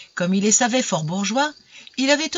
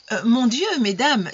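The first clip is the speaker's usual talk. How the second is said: honeyed voice; 6 semitones higher than usual. A narrator's reading. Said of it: "dit-il d'une voix doucereuse".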